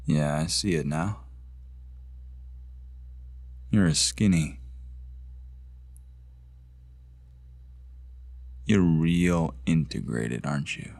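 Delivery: low, deliberate sneer of suspicion; quietly threatening, with long pauses; menacing accusation. Acted. Acted: accuses the interviewer.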